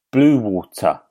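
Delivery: "Blue Water" as two separate words with a sounded T; struggling careful attempt. Shown as a demonstration. Trying to say the shopping centre's name right, careful "Blue Water". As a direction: carefully pull "Blue Water" apart, T pronounced. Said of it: "I can't say it".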